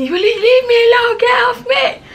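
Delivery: very high, reenacted screech; retold shout. Storyteller replaying being choked by her roommate, high mock screaming. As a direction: screech it like a replayed struggle to break free. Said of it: "You know, I was shouting".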